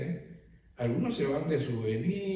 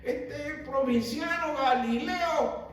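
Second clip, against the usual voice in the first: higher, wondering voice of an astonished crowd; amazed onlookers; 9 semitones higher than usual. Retold or imitated. Preacher voices the crowd amazed at Galilean eloquence, higher and wondering. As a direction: marvel aloud like a stunned bystander. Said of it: "estaban como".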